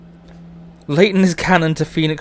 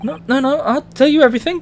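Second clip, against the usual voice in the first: panicked pleading; 10 semitones higher than usual. Acted. Captured character begs the sailor.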